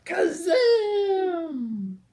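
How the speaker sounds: high, loud spell cry; magic shout. Acted. The spell goes off on the lion, loud high shout. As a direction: shout the magic word like a blast.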